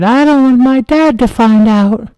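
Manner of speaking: put-on high kid fibbing to firemen; little kid voice. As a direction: play dumb like a small child. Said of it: "I said".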